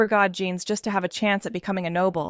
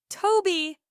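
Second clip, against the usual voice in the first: terrified cry; 8 semitones higher than usual. A narrator's reading. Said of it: "she cried out in terror".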